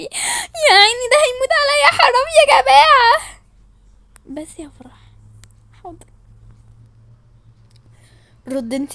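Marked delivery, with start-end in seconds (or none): 0.0-3.4 higher, whiny fake sob; hammed-up weeping over the poor victim; mock crying pity. Mock pity for the pranked friend. (بكاء تمثيلي مبالغ فيه)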